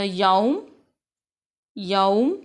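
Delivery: यौम very slow, the औ drawn long; slow model reading. Shown as a demonstration. A Quran teacher models यौम slowly for students. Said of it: "यहाँ पे हम पढ़ेंगे".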